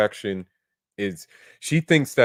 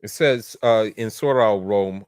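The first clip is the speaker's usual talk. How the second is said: normal talking voice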